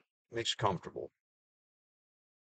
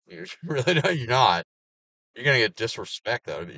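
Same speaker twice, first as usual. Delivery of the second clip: louder, laughing through it; laughing rant; 5 semitones higher, 7 dB louder than usual. Real, not acted. Ranting at people who play victim, laughing loudly. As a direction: laugh in disbelief as you shoot it down.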